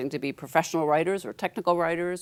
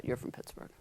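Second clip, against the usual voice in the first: knowing hush; 12 dB quieter than usual. Retold or imitated. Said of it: "And the woman said".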